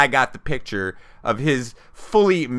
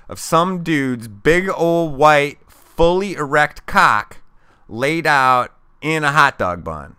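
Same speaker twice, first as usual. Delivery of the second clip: slow reveal; 4 semitones higher, 4 dB louder, slower than usual. Real, not acted. Punchline reveal, slowed for effect.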